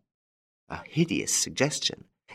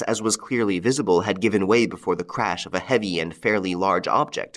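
plain reading voice

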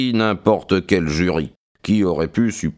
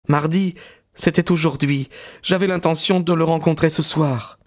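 higher, lightly voiced young woman; young woman's voice; 7 semitones higher than usual. A narrator's reading. Maud speaking to Stackhurst, composed young woman's voice.

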